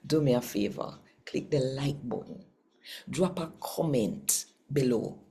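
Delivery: normal talking voice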